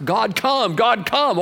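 high, showy calling on God; mock pompous invocation. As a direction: parody a theatrical altar plea. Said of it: "the pomp and circumstances".

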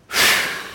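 long breathy sigh of regret; regretful sigh. Acted. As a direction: sigh out like a disappointed father.

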